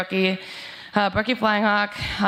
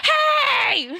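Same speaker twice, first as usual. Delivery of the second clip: sudden high yelled greeting in a put-on voice; mock shout of hello; retold shouted hey. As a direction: call out like a friend spotting you from far off. Friend greets them in retold story.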